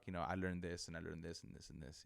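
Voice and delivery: normal talking voice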